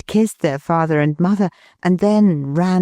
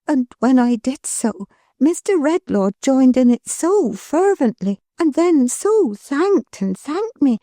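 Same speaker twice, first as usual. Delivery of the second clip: put-on higher, gentle young wife's voice; Milly, tender; 6 semitones higher than usual. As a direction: lovingly reassure her husband. Milly tells of the sick man.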